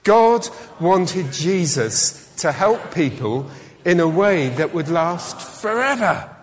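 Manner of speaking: slow, deliberate, spelled-out recital; slow group recital. Shown as a demonstration. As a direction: lead the room word by word. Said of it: "let's say that together".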